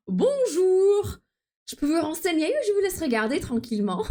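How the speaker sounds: voix de vendeuse aimable; French customer service voice. Shown as a demonstration. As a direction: greet the customer sweetly through a giggle. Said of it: "let me see if I can do it in French".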